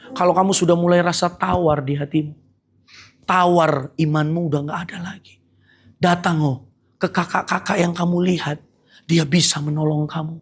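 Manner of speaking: normal talking voice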